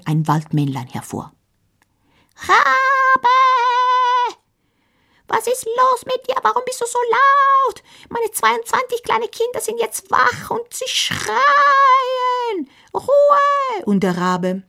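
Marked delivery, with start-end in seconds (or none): 2.4-13.9 very high squeaky scolding voice; a miniature angry father; tiny forest gnome. Acted. The Waldmännlein scolds the raven, very high squeaky voice.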